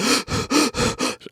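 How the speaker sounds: heavy panicked breaths, imitated; winded gasping. Swimmer standing up, trying to calm down, mock gasping. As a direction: act out gulping for air. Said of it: "I'm trying to calm down".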